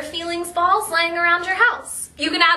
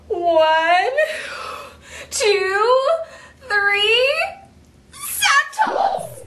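mock wailing; 7 semitones higher, much slower than usual. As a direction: count tearfully and burst out on the toss. Pretend crying while tossing sad ball.

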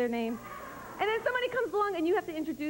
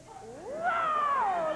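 straining macho guy; 10 semitones higher than usual. Acted. Guy flexing arm.

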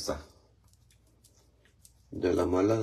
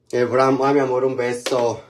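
excited greeting; 4 semitones higher, 6 dB louder than usual. Real, not acted. Sending kiss to viewer.

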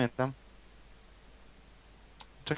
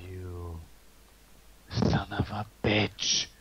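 slow motion speech; 6 semitones lower, slower than usual. Pretend. Repeats slow taunt.

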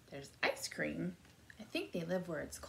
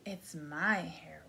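usual speaking voice

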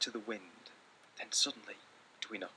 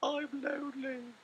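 much higher, slow lonely little voice; lonely little cry; 5 dB louder, slower than usual. Acted. Little Mouse telling Big Mouse he is lonely, high slow plea. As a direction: sound small and alone. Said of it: "he cried".

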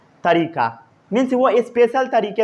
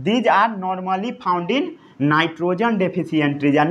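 dictation; 3 semitones lower, much slower than usual. Shown as a demonstration.

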